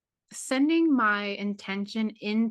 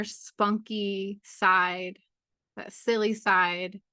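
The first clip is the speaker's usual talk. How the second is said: guided meditation. Acted. Guide leading a full moon journey into the heart, guided meditation. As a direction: ease listeners inward, phrase by unhurried phrase.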